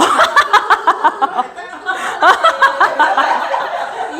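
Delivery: long unrestrained laughter; extended rolling laugh. Real, not acted. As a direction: crack up laughing. Audience joke interrupts lecture.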